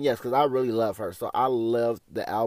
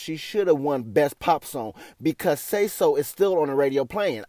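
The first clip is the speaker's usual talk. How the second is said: ordinary talk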